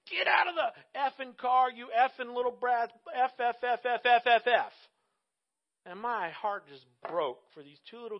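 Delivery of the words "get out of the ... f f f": mock furious yell at kids, louder and higher; retold angry yell. Mom yelling at her kids at the pump, mock angry yell. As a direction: bark it like a frustrated mom. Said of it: "she yells at these kids".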